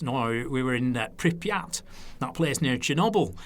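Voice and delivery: easygoing Lancashire impression; Lancashire accent. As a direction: put on a broad Lancashire voice. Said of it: "So it's my Lancashire accent there".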